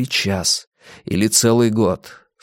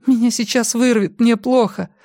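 high, queasy panic; distressed, about to be sick; panicked; 12 semitones higher than usual. A narrator's reading. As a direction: voice pitched high in alarm.

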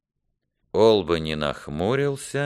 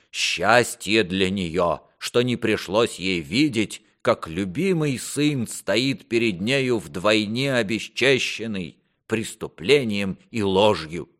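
slow, higher-pitched lament; reproachful, grieving king; 5 semitones higher than usual. Acted. King laments his dishonoured son, pained reproach. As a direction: draw out the bitter reproach.

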